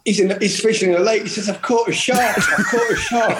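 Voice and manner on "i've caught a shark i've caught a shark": mock excitement. Retelling Gazza's excited phone call.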